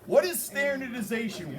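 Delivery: normal talking voice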